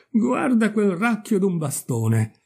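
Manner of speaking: high, slow exclamation; boy calling out. A narrator's reading. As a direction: point it out with a boyish cry. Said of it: "gridò uno dei ragazzini". (esclamazione acuta da ragazzino)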